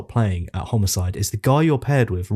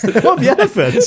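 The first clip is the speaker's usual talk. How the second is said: much higher and louder, laughing through 'elephants'; laughing repeat; 13 semitones higher, 5 dB louder than usual. Real, not acted. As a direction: giggle back the slip 'elephants'. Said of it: "um, elephants".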